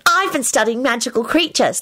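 put-on high, know-it-all girl; smug. A narrator's reading. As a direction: show off self-importantly. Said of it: "replied in a smug voice".

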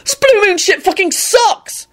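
high-pitched horrified rant; acted outraged hysteria; deranged roaring. A narrator's reading. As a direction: rant as if unhinged. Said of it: "Neville roared with a deranged look".

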